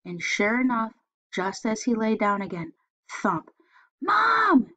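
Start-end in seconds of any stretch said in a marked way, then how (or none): 4.0-4.7 very high, loud cry for Mom; scared kid yelling for Mom. Acted. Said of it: "he yelled".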